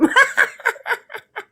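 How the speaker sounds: high delighted burst of laughter. Real, not acted. Friend laughs at story.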